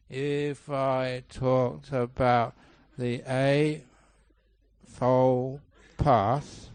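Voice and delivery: painfully slow, with huge gaps between words; mock slow-motion lecture, stretched out; sleep-inducing lecture. Shown as a demonstration. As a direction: parody a dull speaker, plodding word by word. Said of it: "don't talk like that".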